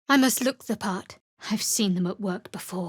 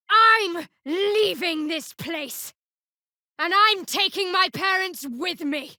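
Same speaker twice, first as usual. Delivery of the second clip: high, slow defiant vow; defiant declaration; 8 semitones higher than usual. Acted. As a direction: declare it with resolve.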